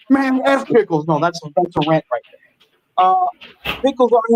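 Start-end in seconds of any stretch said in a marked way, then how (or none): 0.1-1.1 ranting. Shown as a demonstration. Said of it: "A rant is like".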